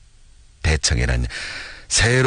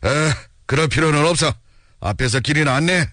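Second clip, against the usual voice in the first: mildly raised, brusque command; understated acted bark; stern shouted order; 5 semitones higher, faster than usual. A narrator's reading. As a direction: dismiss him and order him on. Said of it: "눈을 부라리며 소리를 쳤다".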